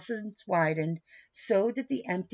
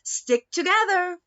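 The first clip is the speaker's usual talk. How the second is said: put-on high, loud call to the kids; 10 semitones higher, 6 dB louder than usual. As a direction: raise the voice to keep them together. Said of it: "Mommy said".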